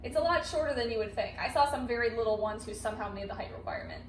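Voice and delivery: ordinary talk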